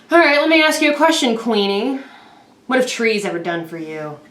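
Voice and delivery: high, loud exasperated sass; sassy challenge. Acted. Dryad confronting the queen in a high, loud, sassy voice. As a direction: sigh then lay into her.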